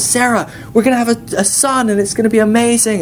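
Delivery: high, thrilled outburst; excited announcement. Retold or imitated. Abraham running home to tell Sarah of a son, excited. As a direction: imitate a husband bursting with good news.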